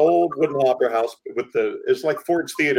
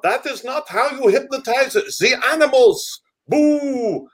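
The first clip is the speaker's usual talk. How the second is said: put-on slow, higher Strangelove-style foreign accent; Doctor Strangelove accent; 9 semitones higher, slower than usual. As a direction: lecture like a mad foreign doctor, slowly. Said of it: "like Doctor Strangelove".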